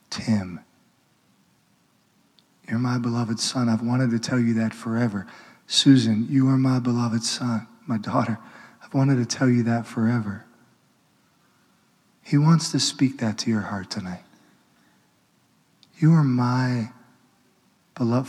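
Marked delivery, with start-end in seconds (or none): 0.1-10.4 tender voice of God. Acted. God telling the congregation they are loved, softly. 16.0-18.3 tender voice of God. Acted. Voicing God.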